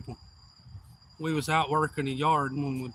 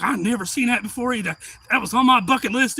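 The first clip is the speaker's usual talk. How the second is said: raised, higher voice; imitating an excited mother; excited mama quote; 7 semitones higher, 6 dB louder than usual. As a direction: exclaim it like a thrilled onlooker. Acting mama's excited remark.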